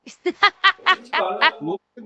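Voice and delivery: rapid staccato cackle; amused ha-ha-ha; laugh. Real, not acted. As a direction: burst out laughing in short pulses. Reactor laughs at the clip.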